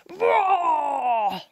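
long, loud troll roar; huge roar. A narrator's reading. Troll bursts out from under the bridge, huge roar. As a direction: let out a big drawn-out roar. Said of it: "there was a huge roar".

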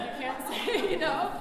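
loud, laughing through the words. Real, not acted. Artist joking about the transcript's wording, laughing. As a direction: let a laugh burst mid-phrase.

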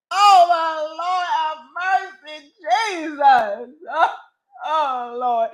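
high, slow, drawn-out plea; hammed-up grieving prayer; mock lament. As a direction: moan it to heaven, melodramatically. Mock lament, calling on Jesus.

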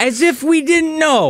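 slow, high mock smugness; sarcastic. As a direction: drawl it out smugly. Sarcastic comment on his own win.

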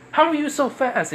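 put-on very high-pitched, astonished girl; acted squeal of disbelief; amazed. Girl to the speedster who dodged her, high-pitched amazement.

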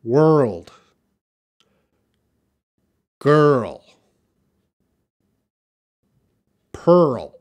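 put-on WORLD, GIRL, PEARL loud, each falling, full R; loud, clear "world", "girl", "pearl" with gaps to repeat; repeat-after-me modelling. Shown as a demonstration. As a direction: project "world", "girl", "pearl" one at a time for the class. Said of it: "just quickly repeat after me".

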